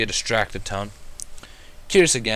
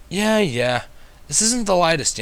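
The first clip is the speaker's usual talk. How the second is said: higher, mildly grumbling dragon; complaining; 8 semitones higher, 3 dB louder, slower than usual. A narrator's reading. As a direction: moan about the heavy bag. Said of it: "he complained".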